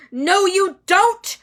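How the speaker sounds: loud, high, slow roar; furious roar. A narrator's reading. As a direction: bellow it at the cat. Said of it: "roared Harry".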